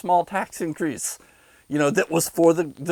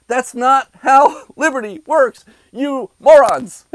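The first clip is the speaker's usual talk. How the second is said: raised, slow, contemptuous yelling at viewers; angry rant; 8 semitones higher, 7 dB louder, slower than usual. Real, not acted. Ranting vlogger yelling at viewers who back lockdowns, slow angry shout. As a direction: spit it out at them, slow and loud.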